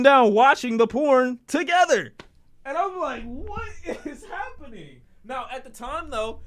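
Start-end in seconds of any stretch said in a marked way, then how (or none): 2.6-6.4 soft laugh through the words; high, quiet giggling question. Real, not acted.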